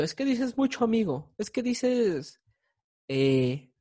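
high, whiny imitation of a critic, slowing at the end; lightly hammed-up voice of a fussy listener; mock picky critic. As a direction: parody someone nitpicking your delivery. Mock critic quoting friends' feedback.

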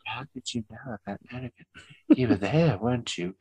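soft whisper through a wall; whispering through a door. Retold or imitated. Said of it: "he was whispering through".

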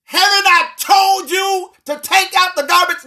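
mother's third time, raising her voice; exasperated mother yell. Retold or imitated. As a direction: yell it from the kitchen, fed up. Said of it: "notice now".

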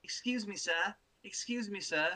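high, prim mock British girl; fake British accent. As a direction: mimic a posh little British child. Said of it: "She sounds like fucking".

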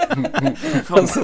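laughing. Real, not acted. Laughing at the story.

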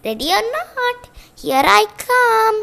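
calling out. Acted. Seeker calls out to hiders.

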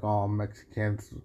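ordinary delivery

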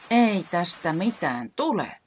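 high, slow, exasperated cry; frustrated wail. Acted. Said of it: "parahti tuskastuneena". (tuskastunut parahdus)

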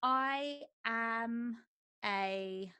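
ordinary talk

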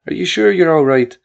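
a frantic ma hearing her son's voice; mother near tears on the phone; worried Irish mother. A narrator's reading. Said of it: "Annie sobbed".

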